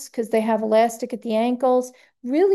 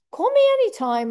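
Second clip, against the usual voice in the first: high, sing-out friendly offer; joyful; 11 semitones higher, faster than usual. Retold or imitated. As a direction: imitate a delighted lady, pitched way up. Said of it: "just so joyful and delightful".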